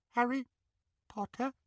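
high, soft trembling squeak; squeaky quiver. Acted. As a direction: quiver like a frightened elf. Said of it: "he squeaked in the tiniest quiver".

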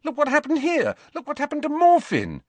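high, loud angry protest; indignant shout. A narrator's reading. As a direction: cry out in outrage. Harry's indignant outburst.

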